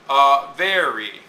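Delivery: slow "a very", light A, weight on VER; unstressed then stressed. Shown as a demonstration. Teacher fitting words into iambic line, "a very" stressed on VER. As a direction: stretch "a very" into beats, hitting VER. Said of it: "start with an unstressed word".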